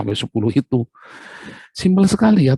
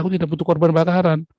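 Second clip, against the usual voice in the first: higher, firm voice of God turning down the offering; God refusing; 7 semitones higher, faster than usual. Acted. God answers the boastful worshipper, firm refusal. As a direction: say it as God brushing off the gift.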